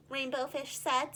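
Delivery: ordinary delivery, nothing marked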